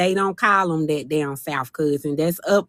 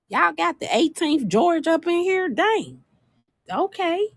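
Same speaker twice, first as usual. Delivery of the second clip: amazed; 9 semitones higher than usual. Real, not acted. Amazed at family names.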